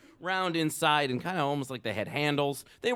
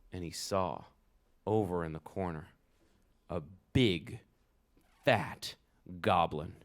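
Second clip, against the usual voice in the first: usual speaking voice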